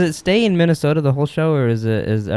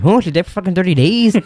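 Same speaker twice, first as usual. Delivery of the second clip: put-on parroting his accidental accent; a friend mocking another's slip into an accent; mock Brad Pitt accent; 6 semitones higher, 3 dB louder than usual. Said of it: "So it kinda".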